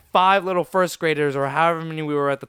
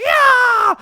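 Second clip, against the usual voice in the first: very high, loud mock war cry; yelling; 11 dB louder than usual. Small kids facing the bigger boy, mock high yelling. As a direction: let rip a squealing battle yell. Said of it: "I was yelling at him".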